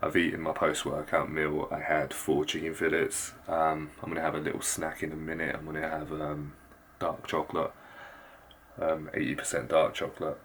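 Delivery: hushed so sleepers stay asleep; soft, low late-night voice; keeping voice low. Real, not acted. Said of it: "I'm gonna have to keep my voice very low".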